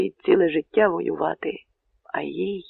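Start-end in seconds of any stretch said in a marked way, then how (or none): none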